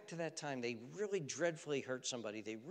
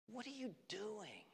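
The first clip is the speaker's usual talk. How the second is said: whispered rebuke; 7 semitones higher, 9 dB quieter, slower than usual. Acted. Repeated, whispered.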